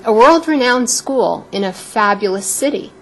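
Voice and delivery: usual speaking voice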